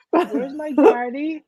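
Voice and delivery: lightly voiced small child, slow and pleading; a hint of a sleepy kid calling for dad; whiny little kid. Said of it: "and he's like".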